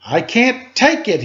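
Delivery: high, loud bellow; mock bellow of a fed-up boy. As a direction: bellow it out in frustration. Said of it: "he bellowed".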